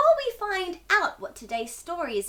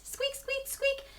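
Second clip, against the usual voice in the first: high squeaky mouse squeak; 10 semitones higher, 7 dB quieter, slower than usual. Shown as a demonstration. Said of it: "little squeaky mice".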